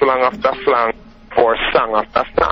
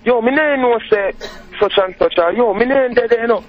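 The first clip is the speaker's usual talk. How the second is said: higher, imitating Spanish Town Jamaican street talk; Spanish Town slang; 10 semitones higher than usual. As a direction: mimic Spanish Town friends in patois. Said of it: "that's the way they talk".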